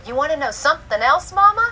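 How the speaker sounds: high and loud, leaping up on Mama; little girl voice. Retold or imitated. Mother voicing her excited daughter, high child voice. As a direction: tease it like an excited four-year-old.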